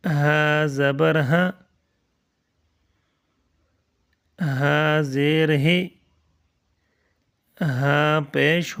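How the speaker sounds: each syllable drawn out with long pauses; slow drilled recitation. Shown as a demonstration. Teacher reciting slowly to children learning letters. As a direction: spell it out slowly for the class. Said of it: "یہ اس طرح پڑھیں گے۔".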